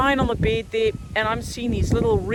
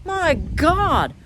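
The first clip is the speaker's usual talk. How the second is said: exasperated outburst, much higher pitch; frustrated swearing; 8 semitones higher, slower than usual. Real, not acted. Venting at YouTube about the failed live stream, exasperated. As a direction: vent at YouTube, pitch jumping up. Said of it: "Okay, I'm gonna swear now".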